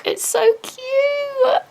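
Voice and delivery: very high, slow, near-crying coo; real emotional squeal; teary squeal. Real, not acted. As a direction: squeak it out, overcome with cuteness. Overwhelmed by the cute artwork.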